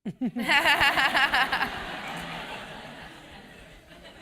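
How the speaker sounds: a big, high burst of real laughter; hearty laugh. Real, not acted. Speaker laughing loudly at the audience's cheeky question. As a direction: laugh it off loudly before answering.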